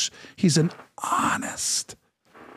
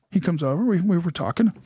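normal talking voice

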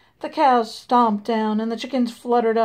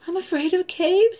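scared chicken; 7 semitones higher than usual. Acted. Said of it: "said one chicken".

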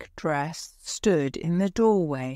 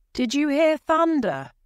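calling out; 10 semitones higher, 3 dB louder than usual. A narrator's reading. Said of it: "she called".

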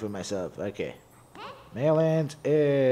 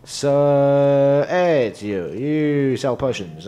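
louder, hammed-up shopkeeper hello; playful loud hello to a potion seller; loud mock greeting; 4 semitones higher, 7 dB louder, much slower than usual. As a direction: greet the merchant with a big show. Greeting shop character loudly.

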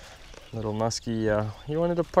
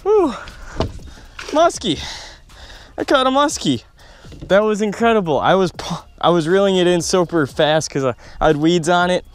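much higher and louder, jubilant; overjoyed at a first catch; elated; 4 semitones higher, 5 dB louder than usual. Real, not acted. First muskie landed.

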